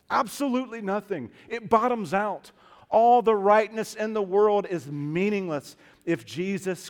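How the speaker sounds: emphatic sermon, punching key words loudly; impassioned preaching. Real, not acted. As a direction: drive the warning home to the congregation. Preaching at full force.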